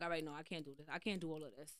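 low, hushed, drained inner voice; quiet. Retold or imitated. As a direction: mutter it to yourself, worn out. Overwhelmed inner voice, quoted.

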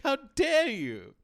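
high, loud, drawn-out mock wail; hammed-up tearful indignation, stretched out loud; mock tearful outcry. Host imitating Kiki in tears, mock tearful outcry. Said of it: "in tears saying".

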